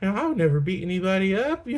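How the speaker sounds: high-pitched mock nice-guy voice; hammed-up sweet guy impression; Jon Bernthal as nice guy. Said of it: "what would Jon Bernthal look like?".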